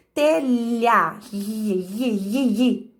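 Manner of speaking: "telha" then drawn-out "i, i, i" mimicking the LH glide; sounding out LH. Shown as a demonstration. Teacher sounding out the LH in telha, a bit goofy. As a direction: repeat the LH sound as a strange "i, i". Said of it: "Então, veja".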